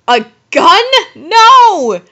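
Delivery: loud, high dramatic protest; alarmed refusal. Acted. Heroine handed a gun by Voldemort, alarmed protest. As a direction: cry out in hammed-up horror.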